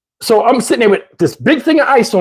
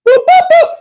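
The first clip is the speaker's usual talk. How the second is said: high beeping dial tones; phone beeps; 5 dB louder than usual. Retold or imitated. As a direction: mimic phone dialing beeps. Imitating phone dialing.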